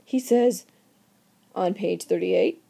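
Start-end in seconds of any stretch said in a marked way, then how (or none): none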